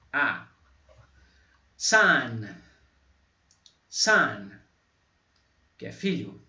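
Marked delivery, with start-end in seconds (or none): 1.8-4.5 Son said louder, open vowel like A; English son. Shown as a demonstration. Teacher modeling son for students, loud demo. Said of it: "Tem um som semelhante ao A".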